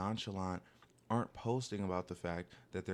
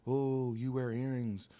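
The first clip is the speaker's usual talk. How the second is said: lightly voiced slow taunting hater; drawn-out mocking jeer; 3 dB louder, much slower than usual. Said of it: "when people hate on me".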